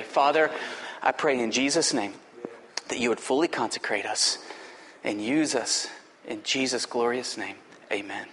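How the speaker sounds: low, soft closing prayer; quiet prayer. Acted. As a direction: hush into a prayer.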